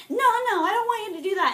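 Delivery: high, loud mock teacher voice; hammed-up high-pitched refusal; objecting teacher. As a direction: refuse urgently, imitating a teacher. Acted teacher refusing the student's plan.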